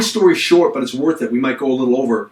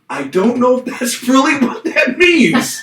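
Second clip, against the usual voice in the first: higher, slow unsaid thought; higher, slow unspoken thought; sheepish aside; 10 semitones higher than usual. Retold or imitated. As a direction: mutter the doubt you kept to yourself. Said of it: "And I wanted to say".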